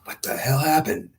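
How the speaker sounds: high, startled cry of confusion; shocked. Retold or imitated. Pilot blown out of the helicopter asks in high startled disbelief. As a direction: blurt it out in disbelief.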